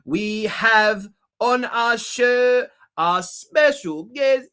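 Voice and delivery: slow, loud showman intro; talk show announcer. Acted. Host to his audience, grand slow announcer read. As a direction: stretch it out like a game show host.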